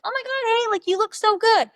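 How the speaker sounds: very high, loud squeal of delight; gushing greeting. Pretend. A girl greeting her date with a side hug, squealing excitedly. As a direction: gush like you're thrilled to see him.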